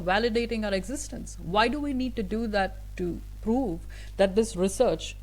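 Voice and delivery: usual speaking voice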